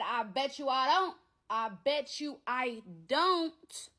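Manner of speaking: high, drawn-out defiant retort; slow right down on the repeat, daring him; defiant comeback. Retold or imitated. Woman retelling her comeback to his jail threat, drawn-out defiance.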